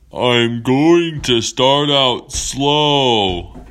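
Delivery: loud, very slow, stretched words; big whale voice. Acted. Whale warning the rider on its back, slow loud call. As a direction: announce it slowly and hugely.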